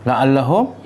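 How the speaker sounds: slow, drawn-out Quranic recitation. Acted. Teacher recites the verse to the class, slow and measured. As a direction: recite each word slowly and carefully.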